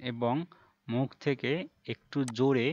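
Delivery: ordinary talk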